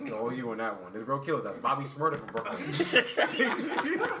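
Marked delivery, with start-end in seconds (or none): 2.3-4.2 slow, through laughter; laughing. Real, not acted. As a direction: laughs while naming him. Joking about Brooklyn killers.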